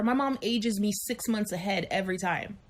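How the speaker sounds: usual speaking voice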